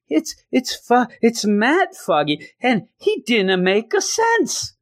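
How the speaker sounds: high, hammed-up tearful voice; badly faked weepy girlfriend; mock crying. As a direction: wail it way up high, slowing at the end. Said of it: "she starts crying".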